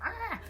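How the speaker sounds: brief high exasperated "ah"; frustrated mock yelp. Partner in a tense conversation, mock frustrated yelp. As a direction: imitate a quick outburst of frustration.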